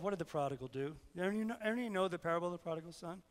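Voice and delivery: normal talking voice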